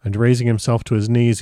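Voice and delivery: ordinary delivery, nothing marked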